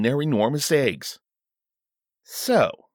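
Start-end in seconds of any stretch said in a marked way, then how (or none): 2.2-2.9 high, drawn-out hesitant opening; struggling for words. A narrator's reading. King struggling to phrase his request, hesitant. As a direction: grope for a way to ask. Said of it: "he struggled".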